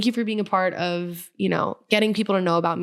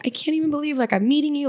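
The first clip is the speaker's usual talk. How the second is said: higher, gushing fan voice; imitating a starstruck fan; 7 semitones higher, faster than usual. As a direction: play the fan freaking out at meeting her. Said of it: "like, freaking out".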